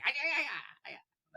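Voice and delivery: mimicked French Canadian babble, high then fading to near-whisper; French Canadian accent. Retelling a wrestler's reaction at the door, mock French Canadian accent. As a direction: imitate a French Canadian, high-pitched and soft. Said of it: "in his French Canadian accent".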